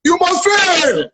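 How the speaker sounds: high, slow, each word pressed; preacher-style insistence on fail; emphatic declaration. Real, not acted. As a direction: drive it home like a verdict. Emphatic advice to Titi.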